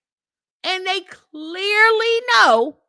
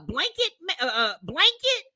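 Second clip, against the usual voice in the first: ordinary talk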